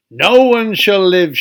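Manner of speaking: loud proclamation; cried out. Acted. Queen cries out threats across the land. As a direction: cry it out as the furious queen. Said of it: "she cried".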